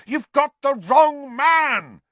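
slow, high, desperate yell. A narrator's reading. Boy defending the wrong man, yelling. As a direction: yell it out, stretching the words. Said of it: "Harry yelled".

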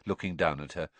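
usual speaking voice

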